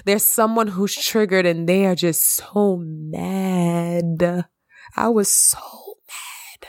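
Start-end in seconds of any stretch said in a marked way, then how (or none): 5.0-6.7 whispered confession of anger, no voice; unvoiced admission of being so mad. Real, not acted. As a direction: breathe it out without voicing, tight with anger.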